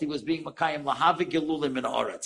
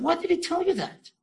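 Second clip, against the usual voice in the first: retold alarm, much higher pitch; startled question; 13 semitones higher than usual. Mother to the visitor about her son, startled. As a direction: act out being taken aback, voice shooting up.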